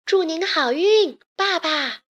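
high, drawn-out farewell call; calling out loudly. A narrator's reading. Daughter calls after her departing father, loud and high. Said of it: "大声地喊道".

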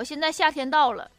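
usual speaking voice

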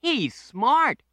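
put-on high proud kid voice; Danny the boy. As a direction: show off your new friend like a boy. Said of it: "said Danny".

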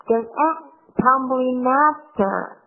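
tumbling after said slowly, the final n linked into after; slow linking demo. Shown as a demonstration. Teacher shows the linking to the class, slowly. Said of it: "这个音也是做连读".

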